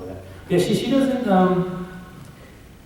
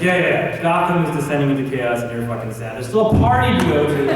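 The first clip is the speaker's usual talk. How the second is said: Alfred; 4 semitones higher, 6 dB louder than usual. Acted. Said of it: "Alfred's like".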